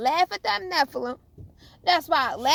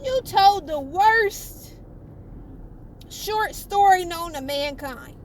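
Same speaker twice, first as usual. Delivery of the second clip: emphatic scorn; 4 semitones higher, 4 dB louder, slower than usual. Real, not acted. Preacher mocking the devil.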